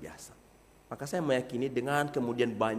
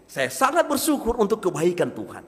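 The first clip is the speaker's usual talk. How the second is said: higher and louder with some conviction; second level; 7 semitones higher, 7 dB louder than usual. Shown as a demonstration. Preacher showing a second, stronger level of thanks, higher and louder. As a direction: give it more life. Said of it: "Lalu tingkat yang kedua".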